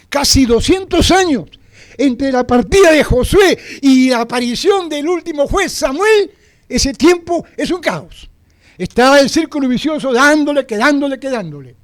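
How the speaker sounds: fervent sermon climax; preaching at full force. Acted. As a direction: rouse the congregation.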